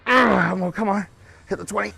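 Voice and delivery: loud strained push through effort; straining grunt. Real, not acted. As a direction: force it out mid-rep. Straining through a heavy set.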